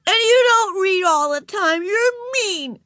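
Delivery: put-on very high, angry little-girl yell; shouting. A narrator's reading. Ramona shouting at Beezus, high and furious. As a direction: yell the accusation in a tiny child's voice. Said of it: "shouted Ramona".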